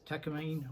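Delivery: Takamine said "TAK-uh-meen"; alternative pronunciation. Shown as a demonstration. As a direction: end Takamine in "-meen", silent final e. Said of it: "I don't know how to pronounce it".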